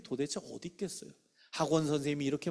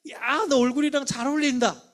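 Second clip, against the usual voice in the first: high, loud burst of amazement; big wowed praise, acted out; admiring teacher; 10 semitones higher, 7 dB louder than usual. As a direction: gush over a child's new look, drawing out the wow. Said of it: "그 선생님이 얘기하는 거예요".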